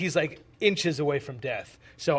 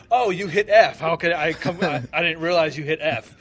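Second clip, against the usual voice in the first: sarcastic mockery; 3 semitones higher, 8 dB louder than usual. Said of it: "I was like".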